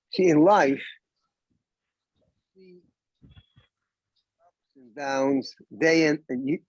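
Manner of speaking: cutting in and out over a bad line; choppy connection, words dropping out; radio breaking up. Real, not acted. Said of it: "your voice coming and going".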